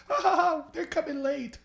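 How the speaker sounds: high, airy fake rich laugh; the couple brushing off the question with a high fake laugh; rich people laugh. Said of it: "rich people laugh different".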